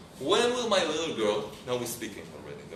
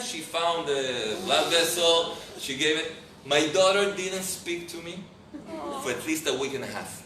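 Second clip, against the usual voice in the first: usual speaking voice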